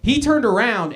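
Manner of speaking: ordinary talk, nothing marked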